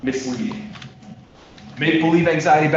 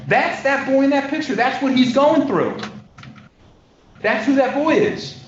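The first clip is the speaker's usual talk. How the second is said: high-pitched, insistent appeal; impassioned plea; 11 semitones higher, 4 dB louder than usual. Real, not acted. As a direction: plead the point high and forcefully. Lawyer's impassioned appeal to jury.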